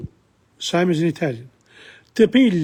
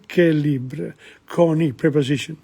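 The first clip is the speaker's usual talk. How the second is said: usual speaking voice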